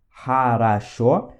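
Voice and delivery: хорошо drawn out slowly as HA-RA-SHO; syllable by syllable. Shown as a demonstration. Teacher showing how X sounds, хорошо syllable by syllable. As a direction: stretch each syllable of хорошо. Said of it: "ele tem som de Ra".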